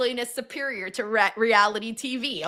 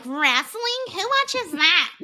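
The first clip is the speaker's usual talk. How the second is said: higher-pitched mocking disbelief; mocking onlookers; 6 semitones higher, slower than usual. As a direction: imitate scornful girls sneering at a fan. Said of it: "two girls that were mocking".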